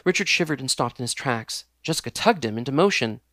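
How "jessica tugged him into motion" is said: ordinary delivery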